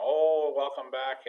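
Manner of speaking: bright, gushing welcome; excited greeting. Retold or imitated. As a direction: greet them with delight. Student greeting driver.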